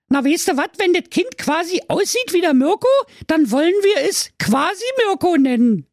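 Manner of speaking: very high, gleeful Berlin wife; delighted call. Acted. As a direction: crow it out with delight. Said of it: "und rief erfreut". (hoch und freudig ausgerufen)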